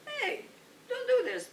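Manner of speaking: put-on high, loud scold at the kids; scolding children. Clerk scolds messy children in shoe store, high and loud. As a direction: snap at the kids to stop. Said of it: "I said, "Hey".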